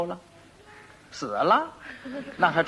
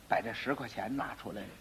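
ordinary talk

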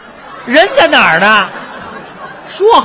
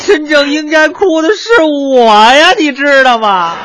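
1 mock crying; 6 semitones higher, 4 dB louder than usual. Comic wails his own woes.

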